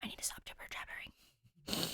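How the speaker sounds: a hushed self-scolding under your breath; whispered aside. Real, not acted. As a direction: whisper it to yourself. Aside to herself about rambling.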